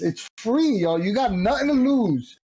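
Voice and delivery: ordinary talk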